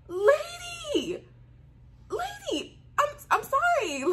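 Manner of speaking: very high squealed protest; high, stammering mock apology; acted startled apology. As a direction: squeal it, stretching the first word. Acting out startled apology to principal.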